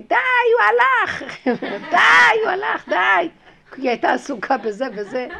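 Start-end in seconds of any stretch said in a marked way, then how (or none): 0.0-1.2 high, loud cry to herself to let go; loud self-scolding. Retold or imitated. Telling herself the man is gone, loud high cry. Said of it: "אז אמרתי לי". 1.9-3.3 high, loud cry to herself to let go; loud self-scolding. Retold or imitated. Telling herself the man is gone, loud high cry. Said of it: "אז אמרתי לי".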